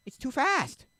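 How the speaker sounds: loud, high yelled complaint; exasperated shout. Real, not acted. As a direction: yell it in protest. Complains about song's tempo.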